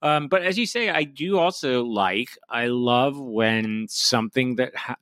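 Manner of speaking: ordinary talk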